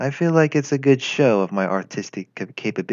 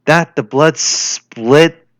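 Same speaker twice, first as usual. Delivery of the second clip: patriotic speech; 4 dB louder than usual. Acted. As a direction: declaim it like a wartime address.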